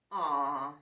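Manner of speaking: sighing, deflated aw; disappointed sigh. Acted. Splat sighing after being outscared. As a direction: let the air out in disappointment. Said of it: "Splat sighed".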